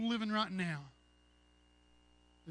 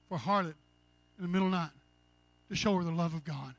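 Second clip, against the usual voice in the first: louder preacher's punchline; preaching; 2 semitones lower, 9 dB louder, faster than usual. Acted. Preacher in the diner answering the cook, preaching loudly. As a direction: press the love of God home.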